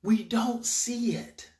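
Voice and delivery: ordinary talk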